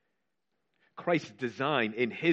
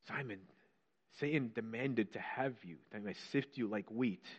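softer, grave reading of Jesus's words; quiet quoting; 8 dB quieter than usual. Acted. Preacher quoting Jesus to Simon, hushed and grave.

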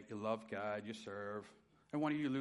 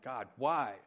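pleading, rising to a high anguished cry; anguished plea, pitch rising toward the end; crying out to God; 5 semitones higher, 5 dB louder than usual. Retold or imitated. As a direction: beg God for an answer, voice climbing. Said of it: "crying out".

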